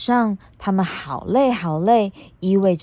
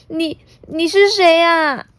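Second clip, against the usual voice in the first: timid voice; 9 semitones higher, 4 dB louder than usual. Acted. Timid T-rex startled.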